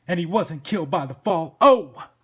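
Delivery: blustering detective boss; exasperated cop Sam. Acted.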